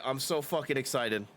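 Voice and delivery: ordinary talk, nothing marked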